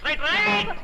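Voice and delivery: high-pitched conductor's call. Acted. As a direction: sing out the signal to go. Sending the bus off.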